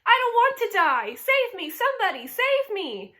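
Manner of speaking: high, loud, desperate cries; panicked screaming. A narrator's reading. Pig screaming for help in the barn. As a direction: beg for rescue at the top of your voice. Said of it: "screamed Wilbur".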